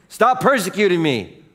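loud, high-pitched rebuke; commanding shout. Acted. Preacher as Jesus confronting fallen Saul, commanding shout. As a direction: thunder it at the fallen man.

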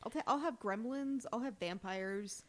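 normal talking voice

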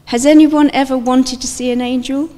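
ordinary talk, nothing marked

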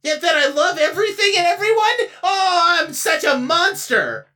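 hammed-up sobbing self-pity; mock crying. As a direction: fake weep, wailing.

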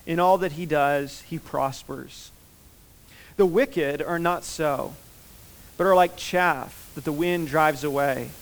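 slow, measured psalm reading; unhurried reading aloud to a congregation; scripture reading. Real, not acted. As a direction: read the verses solemnly and deliberately, phrase by phrase.

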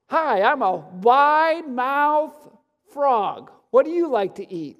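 very drawn-out, high, loud frog voice; wide-mouthed frog. Acted. Frog greeting a snake, exaggerated drawn-out comic voice. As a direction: really stretch out the big-mouthed boast.

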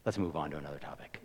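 quick breathy whisper, brushing it aside; hushed brush-off. Retold or imitated. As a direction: whisper it fast like you want the subject dropped. Guy dodging the topic at a wedding.

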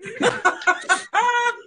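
a high cracking giggle fit; high laughing fit. Real, not acted. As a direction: burst out laughing, pitch way up. Cast cracking up in improv.

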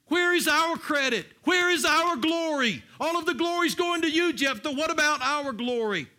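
high, loud outraged demand; indignant shouting. Acted. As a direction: shout out a jealous grievance. Acting out Ephraimites demanding glory.

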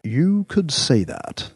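usual speaking voice

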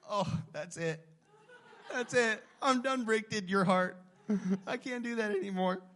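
loud, laughing "I'm done"; laughing mock surrender. Dad telling the church how his daughter melted him, laughing loudly. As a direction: throw up your hands, laughing and loud. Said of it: "And I was like".

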